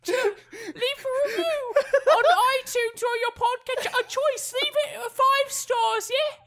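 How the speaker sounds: high, laughing mock cockney orphan voice; hammed-up cockney kid, giggling through it; terrible cockney accent. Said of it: "my terrible accent".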